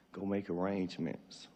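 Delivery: ordinary talk, nothing marked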